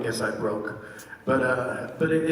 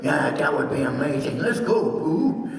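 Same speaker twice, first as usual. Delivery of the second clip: Tigger's voice. Shown as a demonstration. Showing fans how he answered Pooh as Tigger, bouncy tiger voice. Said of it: "I would do it back and forth".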